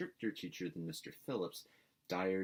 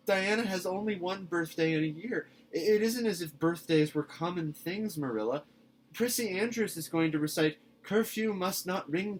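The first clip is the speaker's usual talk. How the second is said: put-on high, bright girlish pleading; a breathless excited girl begging; an eager girl pleading with her guardian; eager young Anne; 8 semitones higher, 7 dB louder than usual. A narrator's reading.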